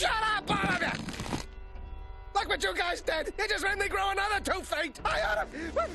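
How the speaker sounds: high-pitched frantic shouting; furious ranting. Acted. Father blaming his children for his growing, furious. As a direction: rant at the whole family.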